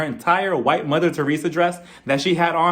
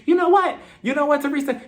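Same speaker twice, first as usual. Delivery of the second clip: mock snapping Margaret; 11 semitones higher than usual. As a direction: pitch it way up like she's about to blow. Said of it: "Margaret was just like".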